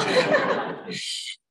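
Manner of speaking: high teary laugh through emotion. Real, not acted. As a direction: laugh to stop from crying. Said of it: "I'm so emotional right now".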